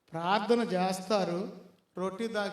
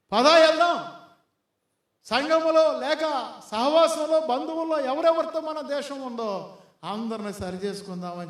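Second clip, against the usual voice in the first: urgent call; 5 semitones higher, 7 dB louder than usual. Retold or imitated. Man urging his wife to go.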